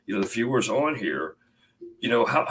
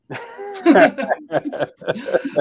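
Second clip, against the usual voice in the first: high burst of laughter; 13 semitones higher, 7 dB louder than usual. Real, not acted. Host laughs at the joke.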